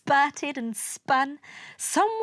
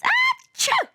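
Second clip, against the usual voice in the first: loud, very high-pitched sneeze; acted sneeze; 6 dB louder than usual. A narrator's reading. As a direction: sneeze from the dust. Venus sneezing from dust.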